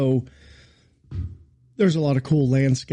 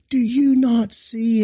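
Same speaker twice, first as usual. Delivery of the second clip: mock angry yell; 9 semitones higher, slower than usual. Pastor mimicking the angry groundsman, raised mock yell. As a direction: play the groundsman tearing into him. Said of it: "he's just ripping me".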